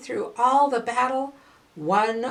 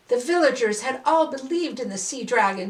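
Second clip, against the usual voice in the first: ordinary delivery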